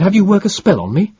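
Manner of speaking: loud, high suspicious jab; defiant retort. A narrator's reading. Captive boy refuses his name, loud high defiance. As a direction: throw the refusal back at him.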